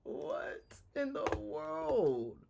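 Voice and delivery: very slow, drawn-out bafflement; baffled disbelief. Real, not acted. Streamer stunned by the clip's bad acting, baffled. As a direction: stretch each word in real disbelief, pitch lifted. Baffled by the clip just played.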